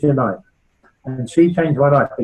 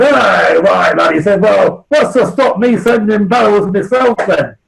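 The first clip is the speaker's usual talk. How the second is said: loud, higher Yorkshire challenge, slowed; challenging northern bloke; 6 semitones higher, 5 dB louder, slower than usual. Retold or imitated. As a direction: call out like a blunt Leeds man. Leeds bloke stands up challenging.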